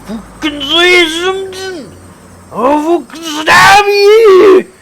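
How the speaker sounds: high, slow, screamed threat; furious threat. Acted. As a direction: roar the threat in his face. Thug threatening narrator.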